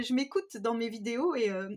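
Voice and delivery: normal talking voice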